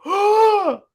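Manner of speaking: high mock gasp of amazement; audience gasp. Theater audience at the Miss Prince moment, imitated gasp. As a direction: imitate a whole theater gasping. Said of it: "you could hear the theater going".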